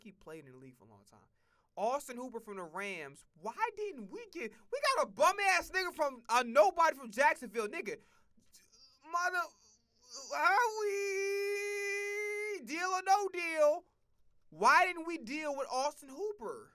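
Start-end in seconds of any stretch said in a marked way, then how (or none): 3.4-8.0 high, loud exasperated complaint; outraged rant. Real, not acted. 9.1-16.7 high, loud, slow wailing plea; mock wail. Real, not acted. Wailing fan complaint.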